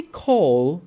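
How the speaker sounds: call, with a long, drawn-out L; stretched call. Shown as a demonstration. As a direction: hold the L of call. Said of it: "make the L longer at the end".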